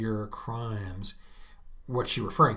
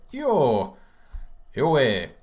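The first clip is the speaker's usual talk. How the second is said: joyful cry; 2 semitones higher, 6 dB louder than usual. Shown as a demonstration. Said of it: "or of joy".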